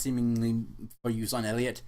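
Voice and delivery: ordinary delivery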